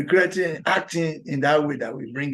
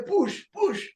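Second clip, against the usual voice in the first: high shouted push push like a delivery nurse; mock nurse shout; 12 semitones higher than usual. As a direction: bark the order much higher. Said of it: "They, they, they say".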